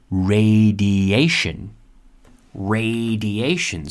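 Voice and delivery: "Radiation" very slowly as ray-dee-AY-shun; careful pronunciation of radiation. Shown as a demonstration. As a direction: stretch each syllable of "Radiation". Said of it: "the intonation here, or the pronunciation".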